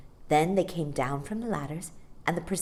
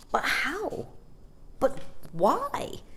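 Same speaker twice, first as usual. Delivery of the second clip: put-on higher, puzzled child's voice; bewildered little boy; 9 semitones higher, much slower than usual. A narrator's reading. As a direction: ask like a boy who can't make sense of it. Michael bewildered by the empty box.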